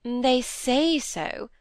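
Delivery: "say" leaned on, slow and doubtful; slow, pointed "say", voicing doubt; stress on say. A narrator's reading. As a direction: draw out "They say so" with weight on "say". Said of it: "laying an emphasis on the word say".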